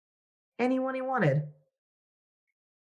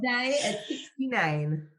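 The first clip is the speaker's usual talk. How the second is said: slow, higher, knowing delivery; suggestive joke; 9 semitones higher, slower than usual. Acted. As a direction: lean into the innuendo. Milking the sixty-nine joke.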